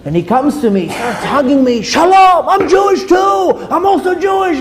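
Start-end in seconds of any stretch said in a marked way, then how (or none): 1.8-4.6 retold ecstatic shouting; excited screaming. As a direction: holler it with over-the-top joy. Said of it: "this guy screams to me".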